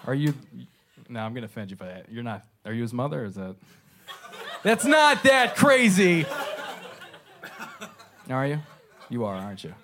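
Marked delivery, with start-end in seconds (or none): 4.6-6.3 high, loud, drawn-out exclamation; loud protest. Real, not acted. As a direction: protest it slowly at full volume. Comedian protests loudly to the crowd.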